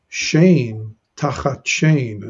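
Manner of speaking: "Shein tachat shein" drawn out very slowly; slow Hebrew reading. Shown as a demonstration. Teacher reads the verse to students, very slow. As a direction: read "Shein tachat shein" syllable by syllable. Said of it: "notice the verse here".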